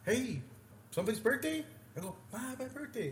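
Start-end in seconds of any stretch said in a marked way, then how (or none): 0.0-1.6 high-pitched call to strangers; high, sing-out approach to a group; tipsy greeting. Retold or imitated. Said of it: "I went like that". 2.3-3.1 put-on high, softer girlish reply; high-pitched mock birthday girl; imitated Italian girl.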